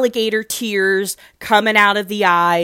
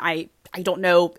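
usual speaking voice

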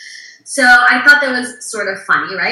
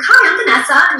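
bubbly; 8 semitones higher, 7 dB louder than usual. Retold or imitated. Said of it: "all bubbly".

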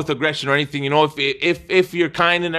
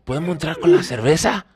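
repeated Spanish plea. Retold or imitated. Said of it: "And I'm like".

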